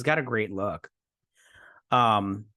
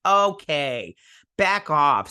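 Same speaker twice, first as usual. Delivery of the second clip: playfully telling someone off; a touch louder, sassy comeback; mock indignant retort; 3 semitones higher, 5 dB louder, much slower than usual. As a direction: push back with mock offense. Acted retort to the coach.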